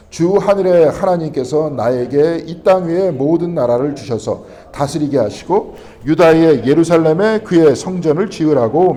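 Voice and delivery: loud, slow, measured Bible reading; scripture reading. Real, not acted. Preacher reads the verse aloud to the congregation, loud and slow. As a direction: read the verse out loud and slowly.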